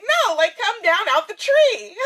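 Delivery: high, loud protest breaking into laughter; exasperated reaction. Retold or imitated. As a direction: call up in laughing disbelief to talk a friend down. Acted reaction to friend's alarming words.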